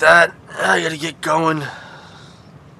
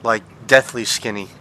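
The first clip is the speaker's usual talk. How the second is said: normal talking voice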